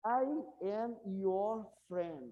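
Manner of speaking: very slow, drawn-out statement; slow model sentence. Shown as a demonstration. Teacher slowly reads the example sentence to students. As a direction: stretch every word for the class. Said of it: "O que que significa essa frase?".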